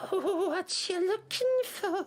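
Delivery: put-on old seer drawing out the question; mysterious old grandmother.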